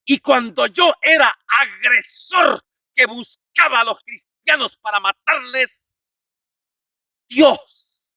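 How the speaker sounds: loud, high, declaimed testimony; full-force preaching. Acted. (predicación a plena voz, declamada)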